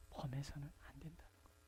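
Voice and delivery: hushed whisper; preacher whispering not to repeat the wrong; whispered warning. Real, not acted. Sermon whispered point.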